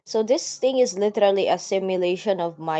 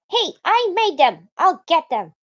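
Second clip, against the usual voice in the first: high, loud and indignant; bratty girl voice; 10 semitones higher, 7 dB louder than usual. Acted. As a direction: snap it like a huffy girl. Voicing Natsuki protesting.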